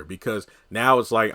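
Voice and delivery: normal talking voice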